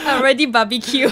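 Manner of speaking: amused, laughing delivery. Real, not acted. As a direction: crack up on your own joke. Joking, both hosts laughing.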